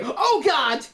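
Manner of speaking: high shocked exclamation; surprised. Real, not acted. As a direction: react in mock horror. Seeing the vampire puppet.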